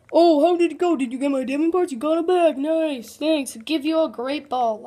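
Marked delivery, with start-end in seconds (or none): none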